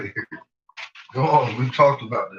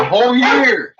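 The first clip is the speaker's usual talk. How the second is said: mock outrage; 10 semitones higher, 8 dB louder than usual. Real, not acted.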